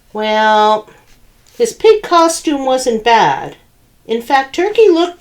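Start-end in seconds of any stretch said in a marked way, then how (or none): none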